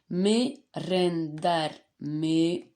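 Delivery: "Merendar" slowly split into me, ren, dar, with gaps; syllable by syllable. Shown as a demonstration. Teacher showing where the syllables of "merendar" fall, slowly syllable by syllable. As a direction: say "Merendar, merendar" one separate syllable at a time. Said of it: "l'avant-dernière syllabe de merendar".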